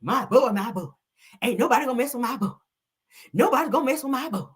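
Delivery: high-pitched country mama voice; Marlene impression. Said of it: "that's how Marlene talk".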